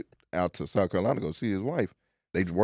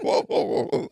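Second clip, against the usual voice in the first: high, laughing whoa in disbelief; 3 dB louder, slower than usual. Retold or imitated. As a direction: crack up while backing away from the story. Shocked at driver's story, laughing.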